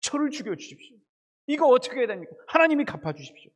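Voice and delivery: high-pitched, mounting cry to God; urgent plea. Acted. Preacher models Jeremiah appealing to God, urgent pleading. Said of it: "하나님한테 그럼 물어".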